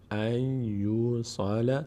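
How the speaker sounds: "ای یوصل" as drawn-out "ay-yusala", no N heard; nun merged into ya. Shown as a demonstration. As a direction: chant "ای یوصل" slowly with a held Y.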